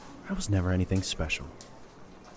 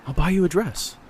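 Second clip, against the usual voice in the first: eager offer; 9 semitones higher, 5 dB louder than usual. Acted.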